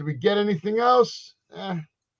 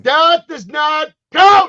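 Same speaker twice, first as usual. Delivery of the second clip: slow, loud, high outraged shout; slow furious protest; indignant shout; 10 semitones higher, 11 dB louder, much slower than usual. Real, not acted. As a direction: slam it down, it does not count. Heated wrestling argument.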